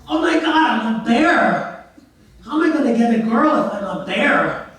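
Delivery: loud, high-pitched cry of mock horror; exaggerated alarm; hammed-up dismay. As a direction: wail it like a man aghast at his new body. Man finds himself a bear.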